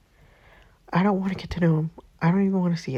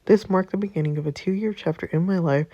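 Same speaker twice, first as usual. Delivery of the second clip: usual speaking voice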